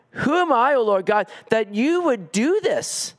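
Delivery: humbled David crying out in amazement; awestruck wonder. Acted. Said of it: "in his own humility".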